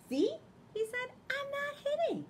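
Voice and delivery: very high, loud little hamster voice; high proud Hamster. Acted. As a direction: show off being good.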